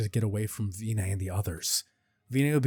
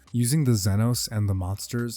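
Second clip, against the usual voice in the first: plain reading voice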